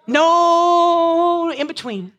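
No in between, long, high and loud; drawn out emphatic. Acted. Preacher insists to the church there's no middle ground, drawn out loud. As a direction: stretch every word with force. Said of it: "I love straight up talk".

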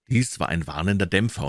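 usual speaking voice

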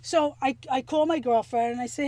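ordinary talk

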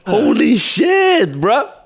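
high, slow, drawn-out disbelief; amazed outburst. Real, not acted. Reacting to an outrageous tampon story, amazed outburst. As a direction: stretch the words out high in amazement.

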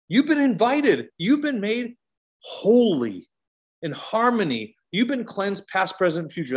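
lifted, fervent preacher's cry; preaching at full force. Acted. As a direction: preach it out with fire. Preaching climax on invitation.

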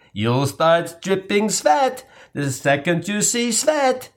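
slow, sinister sing-song chant; diabolical jingle. Acted. Boys recalling the principal's menacing jingle, slow and sinister. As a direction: chant it like an ominous nursery rhyme. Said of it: "diabolical Doctor Seussian jingle".

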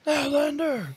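very high drawn-out call; Outlander shout. Retold or imitated. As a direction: call out the villain line. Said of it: "that's his word".

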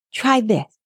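ordinary delivery

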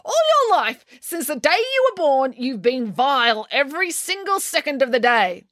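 high, aggrieved outburst; accusing. A narrator's reading. Finn lists April's lifelong vileness, accusing. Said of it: "accused Finn".

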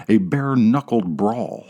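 plain reading voice

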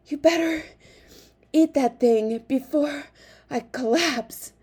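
loud, high, breathless warning; huffing. A narrator's reading. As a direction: heave the words out in winded bursts. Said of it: "Pong huffed".

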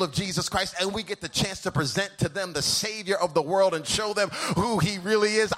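thrilled, almost singing it; excited preacher. Retold or imitated. Preacher imitating his morning self, thrilled to preach. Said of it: "this morning, I'm like".

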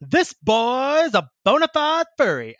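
high-pitched, drawn-out mock Southern announcement; Southern orator. Furry's friend announces big news to the lunchroom, hammed-up Southern drawl. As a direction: proclaim it like a hammed-up Southern speechmaker. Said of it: "like, Confederate Southern".

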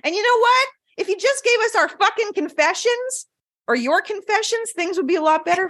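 high-pitched, hammed-up complaint; mock exasperated plea. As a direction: scold the listeners in a high, pleading pitch. Mock scolding of listeners.

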